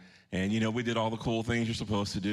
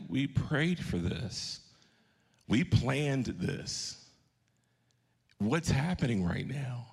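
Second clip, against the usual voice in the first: retold fear; much slower than usual. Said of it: "as I remember being scared".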